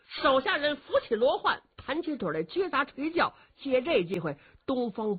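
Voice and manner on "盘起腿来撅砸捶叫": ordinary delivery, nothing marked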